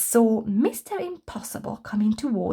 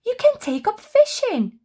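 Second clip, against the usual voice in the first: excited suggestion; 11 semitones higher, 6 dB louder than usual. Acted. Happy excited with idea.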